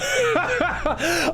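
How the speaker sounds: high squealing laugh. Real, not acted. Bursts out laughing.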